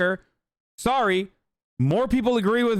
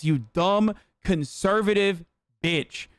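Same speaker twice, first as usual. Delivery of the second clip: normal talking voice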